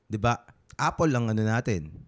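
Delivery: ordinary delivery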